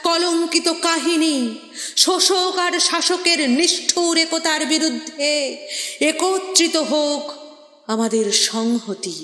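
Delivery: high, sustained, almost chanted declamation; rousing poetic prayer at full voice; impassioned invocation. Acted. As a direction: invoke strength with ringing, held vowels. Poem's rallying invocation for strength.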